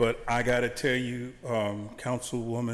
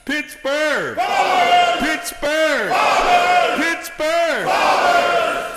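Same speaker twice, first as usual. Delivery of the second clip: crowd cheer shouted; hyped crowd warm up; 10 dB louder, much slower than usual. Real, not acted. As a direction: chant it louder each time. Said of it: "And each time you go louder".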